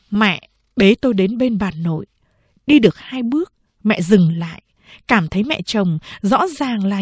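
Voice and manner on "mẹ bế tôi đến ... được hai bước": plain reading voice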